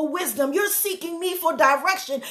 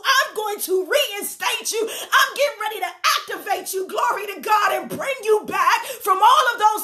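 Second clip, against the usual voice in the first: higher, shouted preaching with laughing joy; preaching at full force; 3 semitones higher than usual. Acted. Pastor promising restoration to the congregation, shouting with joy. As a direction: lift the church with a shouting climax.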